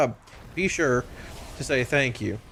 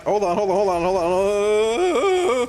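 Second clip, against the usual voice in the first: frantic; 4 semitones higher, 5 dB louder, slower than usual. Real, not acted. Frantic fight with the boss.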